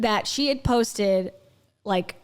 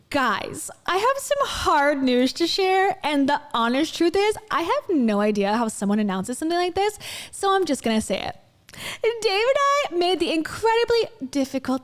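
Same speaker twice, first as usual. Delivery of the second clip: mock divorce announcement; 6 semitones higher than usual. Reactor reading Rachel's caption aloud to viewers, high mock announcement. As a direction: read it hammed-up, pitched well above usual.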